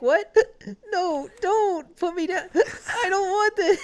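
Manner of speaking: high laughing mock panic; plead, high, through laughter; panicked pleading voice. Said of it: "They're just like".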